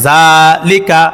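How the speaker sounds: জালিকা read briskly with no vowel lengthening; clipped syllables in জালিকা; quick short reading. Shown as a demonstration. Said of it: "তাড়াতাড়ি করিয়া পড়িতে হয়".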